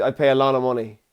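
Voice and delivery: ordinary talk, nothing marked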